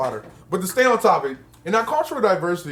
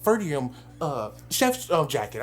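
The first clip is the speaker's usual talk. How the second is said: low, soft, halting mumble with ums; lightly voiced awkward classmate; nerdy student; 4 semitones lower, 6 dB quieter than usual. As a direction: imitate a shy know-it-all, quiet and hesitant. Imitating trench coat student.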